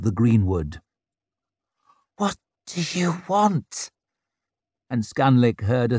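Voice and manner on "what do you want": high, slow, fearful question; quavering with fear. A narrator's reading. Warrior facing the tightening ring, voice quavering with fear. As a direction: let fear quaver through it, pitched high. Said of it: "the quaver of fear".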